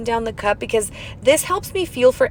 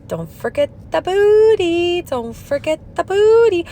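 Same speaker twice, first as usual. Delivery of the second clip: singsong reminder; 7 semitones higher, 3 dB louder, slower than usual. Real, not acted.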